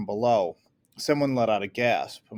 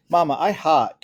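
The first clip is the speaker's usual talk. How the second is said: put-on high, louder little boy complaint; a small child whining to his mother; whiny child; 6 semitones higher, 9 dB louder than usual. Small boy complains in hot attic.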